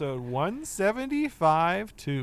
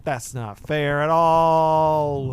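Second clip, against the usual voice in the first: mock whine; 7 dB louder, much slower than usual. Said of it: "I'm so mad".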